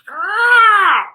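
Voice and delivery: high, shouted squawk; loud crow caw. Acted. Crow cawing after its speech, loud and high. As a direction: screech one long caw.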